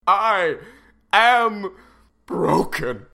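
slow, then loud anguished wail; melodramatic despair. Acted. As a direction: ham up the heartbreak. Father wails in despair.

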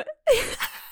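real high cackle; cackling laughter. Real, not acted. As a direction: burst into a helpless cackle. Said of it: "I'm cackling".

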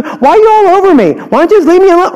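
put-on a caught kid flailing to push the parent away; whiny defensive kid. Kid snapping back at a prying parent, high, fast and whiny.

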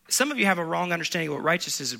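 ordinary talk, nothing marked

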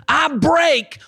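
high, slow, forceful shout; real pulpit shout, building to a peak; preacher's declaration. Real, not acted. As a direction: cry it out with full authority, stretching each word. Preacher breaks curse at full force.

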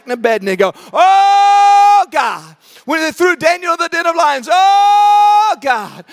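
hammed-up wailing prayer with long, loud, high "Oh"s; calling out to God. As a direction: cry out a mock fervent plea, swelling on each "Oh". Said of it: "There they are calling out to God".

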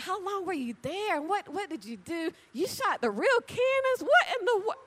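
high, loud eager questions; acted excitement. Faking excitement at a coworker's hobby, high and rising. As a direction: pile on questions like you're thrilled. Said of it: "just act interested".

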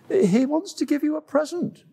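high, prim animal voice; lightly haughty antelope. Acted.

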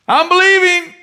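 high, loud insistent yell; hammed-up shout of straining faith; desperate mock cry. Mocked believer insists from the boat.

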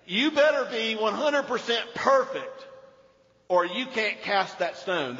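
preacher's forceful mock warning; stern demand. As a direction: lay down an impossible rule. Mock stern demand wrongly attributed to Jesus.

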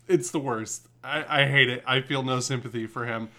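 ordinary delivery

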